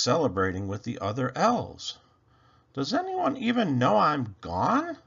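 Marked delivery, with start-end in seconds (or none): 2.7-5.0 wistful wondering. A narrator's reading. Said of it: "he wondered".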